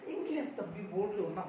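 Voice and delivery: normal talking voice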